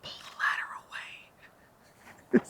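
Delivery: hushed, imitated secret instruction; conspiratorial whisper. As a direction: whisper it like plotting a prank, with pauses. Director secretly sets up a prank.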